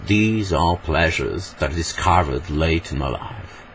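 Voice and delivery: slow, musical English accent; theatrical, drawn-out English accent; overacted English accent. Shown as a demonstration. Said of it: "un peu la musique de la langue anglaise".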